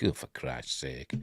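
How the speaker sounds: usual speaking voice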